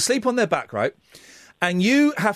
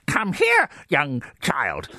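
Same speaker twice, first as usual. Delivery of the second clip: put-on little old lady; 4 semitones lower, much slower than usual. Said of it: "so the little old lady said".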